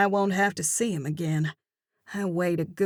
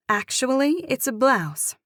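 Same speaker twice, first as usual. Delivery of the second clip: indignant correction; 6 semitones higher than usual. Acted. Narrator correcting the man's word choice, indignant. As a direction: set him straight, chin up.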